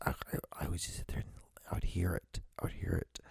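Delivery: hushed, whispered memory; barely voiced, haunted. Real, not acted. Recalling hearing his mother beaten, whispering.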